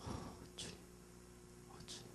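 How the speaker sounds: whispered, barely there reply; humbled believers whispering to the Lord; awed whisper. Acted. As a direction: answer in hushed awe. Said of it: "우리 할 일이 뭐가 있겠어요".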